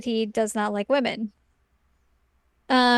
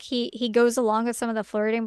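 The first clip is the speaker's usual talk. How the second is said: ordinary talk, nothing marked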